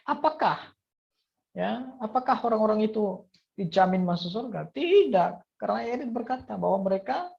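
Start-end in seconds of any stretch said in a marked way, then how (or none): 4.7-5.6 a loud, high, emphatic "Tidak"; firm preacher's no. Acted. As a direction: slam the answer "Tidak" down hard. Emphatic answer to own question.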